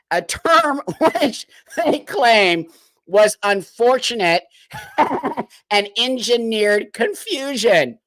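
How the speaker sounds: slow, high, laughing through the words; laughing in disbelief. Real, not acted. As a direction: read it while cracking up at the absurdity.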